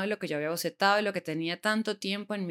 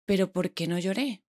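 ordinary delivery

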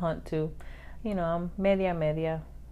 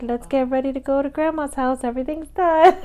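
higher, louder, bright all-clear; cheerful announcement; 6 semitones higher, 4 dB louder than usual. Retold or imitated. To the family once cooking is done, higher cheerful call. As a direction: sing out that the work is done. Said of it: "everybody can come back inside".

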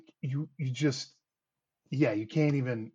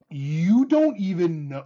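slow, higher, louder imitation of a sister; retold emphatic exclamation; 5 semitones higher, 6 dB louder, slower than usual. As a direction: draw it out with hammed-up emphasis. Sister exclaiming over difficult baby, retold.

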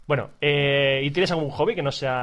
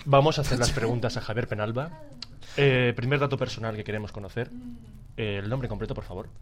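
measured, lower mock-formal interviewer; more professional; 4 semitones lower, 7 dB quieter than usual. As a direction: put on a composed, professional questioning tone, trailing softer. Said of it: "Hazlo un poco más profesional".

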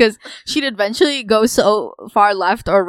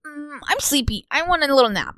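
higher, drowsy whine of a tired friend; sleepy whine; 7 semitones higher than usual. Retold or imitated. Friend mimics Ren asking to nap, sleepy whine. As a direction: fake a sleepy little whine. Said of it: "Ren was like".

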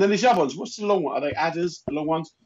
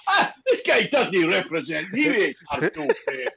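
slow, higher put-on Scottish accent; mock Scottish local, laughing through the end; 6 semitones higher, slower than usual. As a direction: drawl it like a Highlands Scot.

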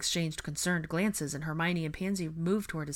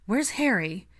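raised, louder anxious question; 5 semitones higher, 6 dB louder, slower than usual. A narrator's reading. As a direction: ask with urgent worry. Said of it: "Katie asked anxiously".

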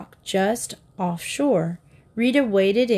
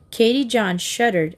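ordinary delivery

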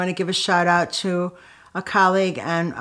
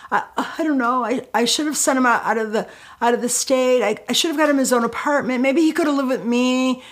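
higher-pitched, grasping run of suggestions; rattling off ideas; 6 semitones higher than usual. Retold or imitated. Upset worker answering her colleague, rattling off unrealistic fixes. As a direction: imitate yourself throwing out desperate fixes, pitched up. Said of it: "started s-, rattling off all these really unrealistic things".

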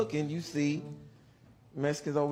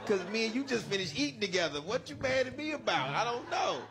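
higher-pitched, anxious protest; nervous pleading; 5 semitones higher than usual. Retold or imitated. Inmate reasons with his cellmate, high and nervous.

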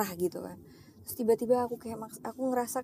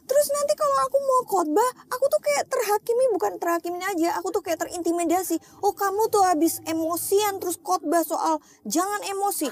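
much higher and louder, a retold tearful outcry; crying out to God; 8 semitones higher, 3 dB louder than usual. Crying alone to God about office stress, high and loud wail. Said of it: "nangis, nangis".

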